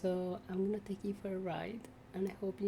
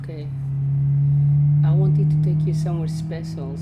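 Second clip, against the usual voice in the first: loud, lower excited talk; 4 semitones lower, 21 dB louder than usual. Real, not acted. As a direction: call out, relieved. Loud after car starts.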